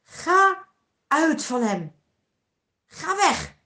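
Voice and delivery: loud, commanding order; stern voice. Acted. Jesus orders the evil spirits out of the man, stern and loud. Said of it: "met een strenge stem".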